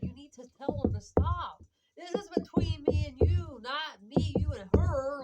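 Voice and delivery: loud, emphatic telling-off; firm retort. Retold or imitated. Woman confronting the man about his girlfriend, loud and insistent. Retold confrontation over her trapping accusation.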